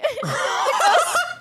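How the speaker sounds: high, loud laughing squeal; real high laugh; burst of laughter. Real, not acted.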